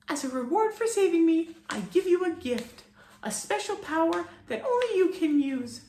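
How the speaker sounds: very high, grave fairy bestowing a gift; fairy warning the boy about responsibility; solemn tiny fairy. A narrator's reading. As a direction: grant the power with solemn warning.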